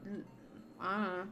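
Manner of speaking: ordinary talk, nothing marked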